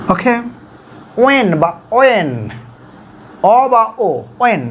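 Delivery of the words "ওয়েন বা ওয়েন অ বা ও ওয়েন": ওয়েন starting on অ or ও, never হ; no h sound. Shown as a demonstration. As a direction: model ওয়েন with a vowel start. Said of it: "এইচের উচ্চারণ আসবে না". (হ ছাড়া ওয়েন)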